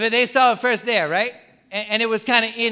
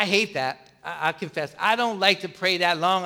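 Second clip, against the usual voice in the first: ordinary talk, nothing marked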